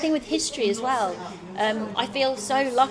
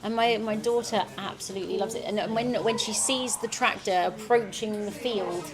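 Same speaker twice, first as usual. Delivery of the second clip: ordinary talk, nothing marked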